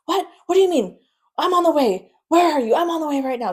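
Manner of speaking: imitating a panicked grandmother, loud and high; frantic. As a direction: fire off urgent, high-pitched cries. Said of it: "Just, like, frantic".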